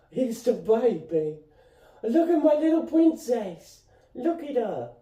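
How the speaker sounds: high-pitched cooing pet voice. Real, not acted. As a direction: coo over it like a little princess. Cooing over a pet cat.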